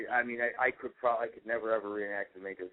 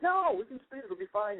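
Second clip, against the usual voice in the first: carefree daughter; 13 semitones higher than usual. Retold or imitated. Daughter voiced in retold story.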